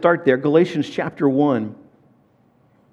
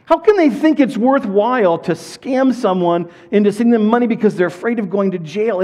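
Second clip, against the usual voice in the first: exasperated disbelief; 4 semitones higher than usual. Retold or imitated. Pastor wonders aloud at scammers, higher and exasperated. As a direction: plead it out in disbelief. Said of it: "thinking to myself".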